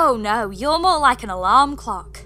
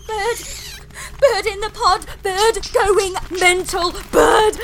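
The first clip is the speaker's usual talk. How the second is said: panicked shout, much louder; 5 semitones higher, 3 dB louder than usual. Acted. Jemima yelling at the attacking bird, a loud panicked shout. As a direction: yell it as the bird flies at you.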